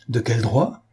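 mildly indignant echo of the question; impetuous outcry. A narrator's reading. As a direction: throw it back impulsively. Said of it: "avec une impétuosité qui l'entraîna trop loin".

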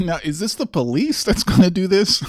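laughing through a mocking question; laughing disbelief. Real, not acted. Laughing at the governor's clip, incredulous. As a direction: ask it while laughing, incredulous.

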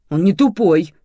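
loud, hurt retort; stung and raising the voice; offended. A narrator's reading. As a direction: protest as if personally insulted. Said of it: "обиделась Светлана Степановна".

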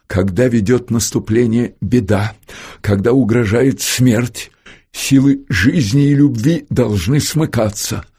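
elevated, ringing rhetoric; lofty oratory. A narrator's reading. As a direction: proclaim it like a solemn, stirring maxim. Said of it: "Именно таким возвышенным слогом и подумалось".

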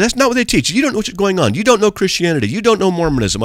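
high, insistent mock yell; imitated yelling. Driver telling preacher he knows nothing, imitated yelling. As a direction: act out a heckler piling on accusations. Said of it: "he starts yelling at me".

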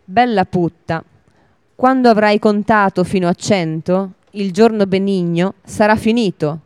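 usual speaking voice

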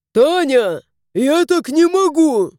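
high, loud desperate complaint; exasperated husband. A narrator's reading. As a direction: at the end of his rope.

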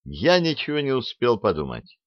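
plain reading voice

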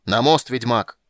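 loud, urgent command; shouted order. A narrator's reading. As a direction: call out over falling rocks. Calling orders during rockfall.

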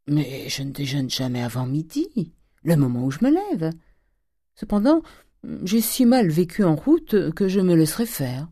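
lightly mannered Parisian dandy, lilting upward; lightly voiced, leisurely dandy; affected young dandy. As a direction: play a pampered young man of fashion.

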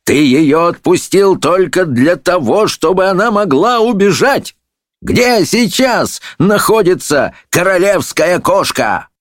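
high, drawn-out accusation; indignant high-pitched interrogation; judge on the attack. A narrator's reading. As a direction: demand answers in outrage. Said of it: "набросился судья на волшебника".

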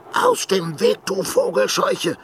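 furious snarl. A narrator's reading. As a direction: spit it at the creature in rage. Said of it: "fauchte er wütend".

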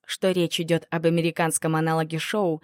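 ordinary delivery

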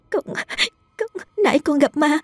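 high, stammering tearful voice; sobbing. A narrator's reading. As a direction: stutter through tears like a scared child. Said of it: "vừa mếu máo vừa đáp". (giọng mếu máo run sợ)

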